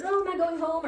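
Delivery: a hint of an eager pup; dog's excited thought. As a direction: voice the dog's hopeful question. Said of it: "He's like".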